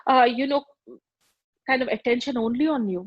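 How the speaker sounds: ordinary delivery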